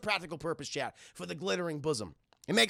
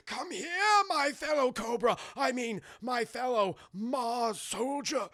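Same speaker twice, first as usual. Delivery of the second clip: the Doctor; 4 semitones higher than usual. Retold or imitated. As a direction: coax grandly like an overacting mad scientist. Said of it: "he talks like this throughout the entire movie".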